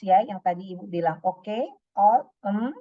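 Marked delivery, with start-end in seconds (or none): 1.2-2.7 filler words demo. Shown as a demonstration. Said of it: "Atau filler words".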